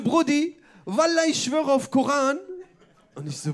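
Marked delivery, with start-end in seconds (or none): 0.0-2.4 loud, higher-pitched, drawn-out slang oath; hammed-up street-talk impression, loud; mock street-slang girl. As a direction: imitate a brash girl swearing it, stretching the words. Acted quote of loud girl on train.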